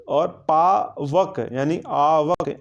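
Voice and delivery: "पावक" and "आवक" said very slowly, bringing out आव; slow pronunciation. Shown as a demonstration. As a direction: break "पावक" down slowly to show the आव sound. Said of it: "जैसे उच्चारण आप करिए इसका". ("पावक" को बहुत धीरे बोलकर आव दिखाना)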